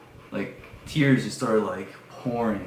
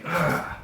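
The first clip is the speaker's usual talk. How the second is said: short, high mock scream; high yelled "ah"; imitated scream; 7 semitones higher than usual. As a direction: scream like the creature, briefly. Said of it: "he, like, screams".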